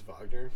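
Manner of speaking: Wagner with a V sound, Vahg-ner; German-style Wagner. Shown as a demonstration. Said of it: "I think it's Wagner".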